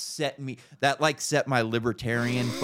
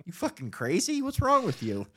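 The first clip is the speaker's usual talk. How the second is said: mock disbelief; 5 semitones higher, 3 dB quieter than usual. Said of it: "And I'm like".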